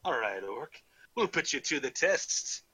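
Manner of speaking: challenging, hammed-up Scottish burr; horrible Scottish accent. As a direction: set the challenge in mock Scottish. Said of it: "back to my horrible Scottish accent".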